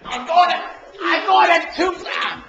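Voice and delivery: scared and angry. Real, not acted. Fighting back, shouting.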